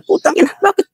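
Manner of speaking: high, sharp exclamation of disbelief; exasperated outburst. Retold or imitated. The officer is exasperated the speaker asked for help so late, voiced in a high, cursing burst. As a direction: burst out with a high, cursing "why only now". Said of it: "Sabi niya".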